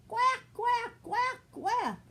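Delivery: high, loud quacking; loud duckling quacks. Acted. Ducklings quacking back at speeding cars, loud high quacks.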